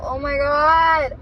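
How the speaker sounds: slow, high and loud, melting over the puppy; drawn-out cooing gush. Real, not acted. Gushing over a husky puppy.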